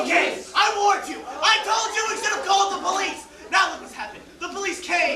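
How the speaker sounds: high, heated I-told-you-so; worked-up accusation; agitated blaming. Acted. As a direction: shout it in rising panic. Panicked blame as police arrive.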